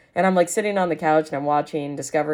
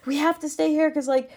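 mock scared friend; 11 semitones higher than usual. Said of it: "so scared".

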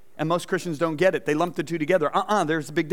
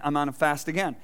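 normal talking voice

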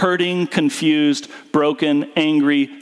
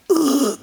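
reluctant groan; 11 semitones higher than usual. Retold or imitated. Reluctant reaction to the Spirit.

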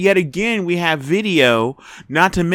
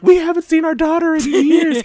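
high, put-on voice of a fretting parent; lightly mocking grieving relative; mock worried family; 12 semitones higher than usual. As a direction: mimic the family's TV plea. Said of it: "the family is constantly on T V going like".

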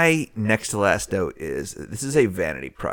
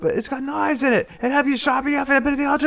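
high, hurried mock British accent; faster than usual. Riffing on [name]'s accent with co-host, high mock British. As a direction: rattle on in a hammed-up British voice. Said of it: "she's doing the British accent".

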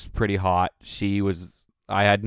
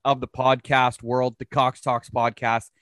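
podcast host intro; 4 semitones higher than usual. Acted. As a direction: announce the guest like a ring announcer.

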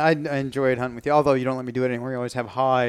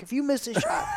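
much higher mock yell; mock shout; 10 semitones higher, 6 dB quieter than usual. Client mimicking the guide yelling a warning. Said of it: "the whole time you're like".

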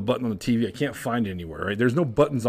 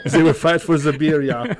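ordinary talk, nothing marked

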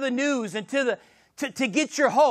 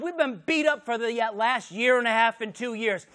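ordinary delivery

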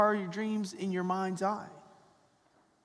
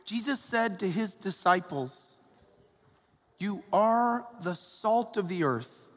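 Gospel reading. Acted. Proclaims the Gospel at Mass.

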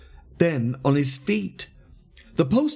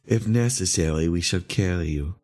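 low, restrained threat; grim; 6 semitones lower than usual. A narrator's reading. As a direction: say it grimly, pitched down. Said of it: "said the leader grimly".

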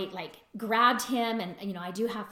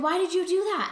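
imitated upset protest, high and loud; retold complaint, pitched right up; kind of upset; 10 semitones higher, 7 dB louder, faster than usual. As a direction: play the husband demanding an explanation. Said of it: "He was kind of upset".

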